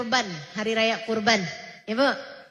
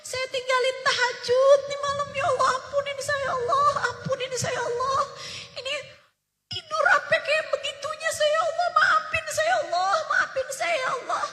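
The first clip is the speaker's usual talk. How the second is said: retold tearful plea to God, much higher and crying; imitating a man begging forgiveness through sobs; high, wailing cries of repentance; sobbing prayer; 13 semitones higher, 3 dB louder than usual. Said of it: "Beliau nangis terisak-isak".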